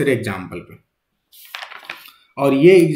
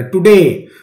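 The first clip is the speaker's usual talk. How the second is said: Today with डे hit louder, टु unstressed; stress on second syllable; 5 dB louder, much slower than usual. Shown as a demonstration. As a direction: demonstrate today leaning hard on डे. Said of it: "तो यहाँ पे डे पे".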